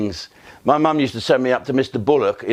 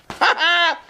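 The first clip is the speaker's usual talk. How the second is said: high burst of laughter. Real, not acted. Laughing at own punchline.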